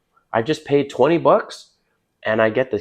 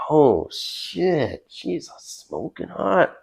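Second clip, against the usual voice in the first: whispered thought; 5 semitones higher, 4 dB quieter, much slower than usual. Retold or imitated. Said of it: "And you're like".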